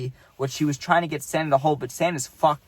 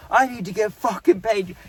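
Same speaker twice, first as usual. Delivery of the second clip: higher-pitched mock outburst; imitated angry swearing; 6 semitones higher than usual. Santa drops the act to demand pay, imitated swearing. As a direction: demand it like a fed-up worker, acted out. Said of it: "He starts just swearing".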